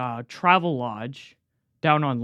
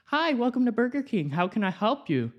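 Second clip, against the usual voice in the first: cashier greeting; 7 semitones higher, 3 dB quieter than usual. Retold or imitated. Imitating his cashier greeting.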